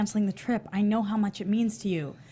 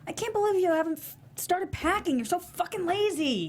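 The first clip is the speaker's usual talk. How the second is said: higher-pitched exasperated scolding; angry scolding; 8 semitones higher than usual. Acted. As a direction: tear into him for not packing. Wife barges in scolding husband.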